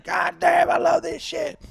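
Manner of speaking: high, loud hammed-up glee; excited old guy. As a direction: whoop like a thrilled old man. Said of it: "he's like".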